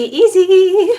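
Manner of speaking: "easy" stretched high and loud; sing-song high easy. Shown as a demonstration. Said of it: "I love the easy".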